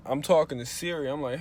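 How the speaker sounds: ordinary delivery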